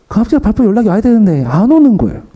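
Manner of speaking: rising anxious storytelling; anxious emphasis. Retold or imitated. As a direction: stress the dread of no call. Anxious retelling of waiting.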